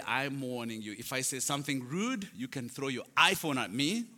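ordinary delivery

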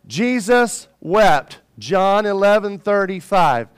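slow, emphatic verse recitation; leading a recitation. Shown as a demonstration. Preacher teaching the congregation a short verse, slow recitation. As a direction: spell it out slowly for a group to repeat. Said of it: "Say it with me".

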